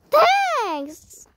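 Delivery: squeaky thanks. Acted.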